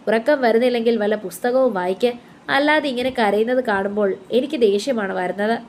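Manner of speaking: usual speaking voice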